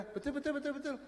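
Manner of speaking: very high squeaky parroting; imitating a child. Child answering every question alike, very high mimicked chant. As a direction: chant it in a tiny cartoon-like voice. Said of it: "Semua jawaban betul".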